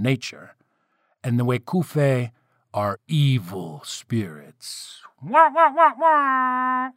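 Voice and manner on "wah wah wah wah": high, loud "wah wah" sting; hammy melodramatic wah-wahs, last one held; mock villain sting. Narrator jokes after announcing evil spirits, mock villain sting.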